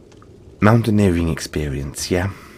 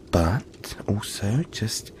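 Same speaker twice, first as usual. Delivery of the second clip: whisper; 5 dB quieter, slower than usual. Acted. Soft aside to the listener.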